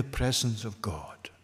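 hushed reverent whisper; whispered emphasis on God's presence. Real, not acted. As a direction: breathe it out as if in awe. Preacher drops to whisper for emphasis.

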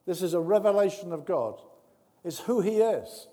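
ordinary talk

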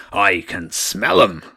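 slow, loud sniffing menace; booming voice. A narrator's reading. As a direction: boom it slowly like a hunting beast. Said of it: "the voice boomed".

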